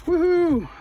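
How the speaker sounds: high loud cheer; excited whoop. Real, not acted. Celebrating success.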